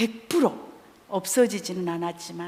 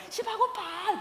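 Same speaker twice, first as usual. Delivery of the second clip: mock exasperated outburst; 11 semitones higher, 6 dB quieter than usual. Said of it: "그래".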